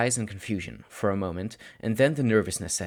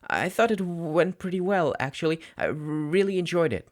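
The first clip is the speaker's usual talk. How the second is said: put-on much higher, anxious boy's voice; nervous teen; 8 semitones higher than usual. Student defending his work to his teacher, high and nervous. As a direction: talk yourself into sounding fine.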